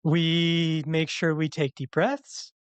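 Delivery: every line rising like a question; up talk yoga teacher. Shown as a demonstration. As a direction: lilt upward like a yoga instructor. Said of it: "sounds like everything you're saying is a question".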